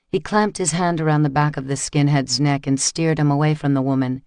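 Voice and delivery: ordinary delivery, nothing marked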